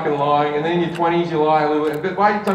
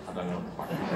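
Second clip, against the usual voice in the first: much softer, lower muttered aside; lower, much softer baffled aside; under-breath mutter; 12 semitones lower, 14 dB quieter, much faster than usual. Retold or imitated. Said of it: "standing up to the side".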